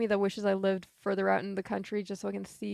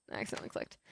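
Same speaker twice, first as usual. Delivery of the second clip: whispering; 11 dB quieter, much faster than usual. Real, not acted. Whispered aside.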